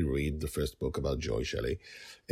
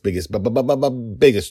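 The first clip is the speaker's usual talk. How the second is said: building hype; 6 semitones higher, 11 dB louder, faster than usual. Acted. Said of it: "This is the biggest".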